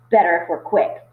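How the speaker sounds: lightly voiced Frederick pressing to hurry. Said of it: "said Frederick".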